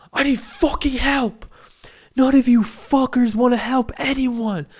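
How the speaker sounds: high, loud acted outburst; angry shouting. Man to whole waiting room, angry acted shouting. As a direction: imitate a furious man yelling at everyone.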